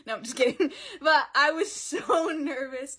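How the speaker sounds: loud, laughing aside after a joke. Real, not acted. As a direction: break into real laughter mid-sentence. Laughs at her own joke.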